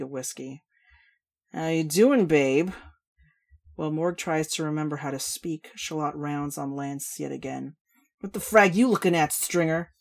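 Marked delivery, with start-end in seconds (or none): 1.5-2.7 slow boozy complaining; brash drunk woman. Acted. Shal flirts. 8.2-9.9 loud, high, drawling tipsy rant; brash drunk woman. Acted. Shal snaps at Lance.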